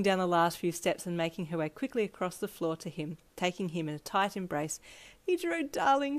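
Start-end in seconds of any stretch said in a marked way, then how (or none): 5.3-6.2 a touch of tearful, higher pitch; lightly tearful as she holds him; mild acted sob. Mum hugging Ichiro, lightly acted tearful. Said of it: "she sobbed into his shoulder".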